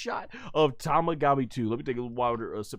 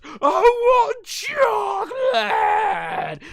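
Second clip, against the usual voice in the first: much higher, louder, slow crying shout; temper tantrum wail; 9 dB louder, much slower than usual. Retold or imitated. Majin Buu throwing a tantrum for chocolate, wailing shout. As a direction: bawl it like a spoiled child throwing a fit. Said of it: "having a temper tantrum".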